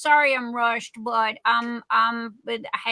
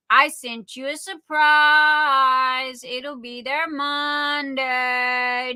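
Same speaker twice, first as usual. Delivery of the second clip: slow, drawn-out singsong tease; sing-song teasing; much slower than usual. Real, not acted. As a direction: stretch it out like a playful secret. Teasing a friend about a surprise.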